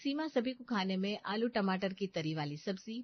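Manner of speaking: ordinary delivery, nothing marked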